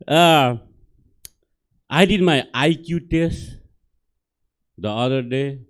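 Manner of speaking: normal talking voice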